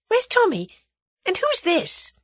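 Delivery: high-pitched lively woman's questions; eager questioning. A narrator's reading. Tuppence asks after her friend and the stranger, eager. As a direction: fire off questions, pitch right up.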